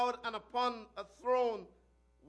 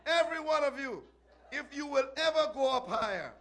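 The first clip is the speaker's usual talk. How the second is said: urgent preached shout; 3 semitones higher, 4 dB louder than usual. Acted. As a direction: call the crowd to repentance with force. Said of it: "And he shouted".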